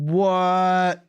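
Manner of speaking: high, loud, drawn-out exclamation; amazed. Real, not acted. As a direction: stretch it out in amazement. Amazed at the Lego set news.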